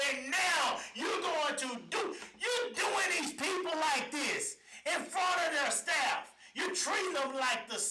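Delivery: slow, high, indignant shouting; real heated outrage; getting mad. Real, not acted. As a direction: hammer out each accusation slowly. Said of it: "but now I'm getting mad".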